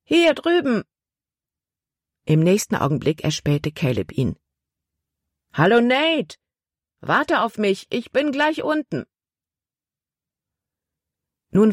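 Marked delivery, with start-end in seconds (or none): 0.0-0.8 calling out. A narrator's reading. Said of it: "rief er". 5.5-9.1 high, called greeting to a friend below; calling down. A narrator's reading. Caleb calls down to Nate.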